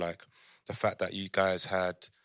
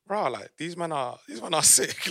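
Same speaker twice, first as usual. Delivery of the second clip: amazed and hyped; 8 semitones higher than usual. Retold or imitated. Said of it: "I was like".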